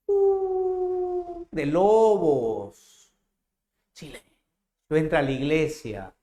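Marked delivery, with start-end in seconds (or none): 0.1-3.1 high drawn-out wolf howl, then slow; mock wolf howl. Imitating wolves' howl.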